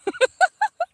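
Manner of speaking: high burst of laughter. Real, not acted. Gardener laughing at herself for whispering, high giggle. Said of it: "Why am I whispering?".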